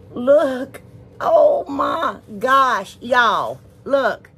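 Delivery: high, loud, drawn-out amazement; thrilled gushing. Real, not acted. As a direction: marvel at it, punching each word. Showing off napkins she adores.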